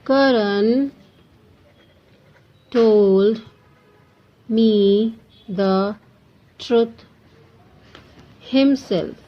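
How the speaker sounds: slow, each word set apart by long pauses; word-by-word dictation. Shown as a demonstration. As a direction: dictate it one word at a time for students to write. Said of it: "teacher dictates example sentence".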